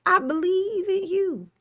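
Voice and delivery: high, cheering on; rally them on; high encouraging. Real, not acted. Cheering on the goal.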